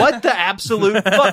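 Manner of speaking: high, loud disbelief; incredulous outburst. Real, not acted. Incredulous reaction to the plot.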